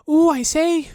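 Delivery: high, loud piglet squeal; excited squeal. A narrator's reading. Piglet jumping about making noises, high loud squeal. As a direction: squeal it in a fluster. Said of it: "making noises".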